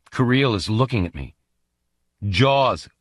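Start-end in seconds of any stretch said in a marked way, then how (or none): none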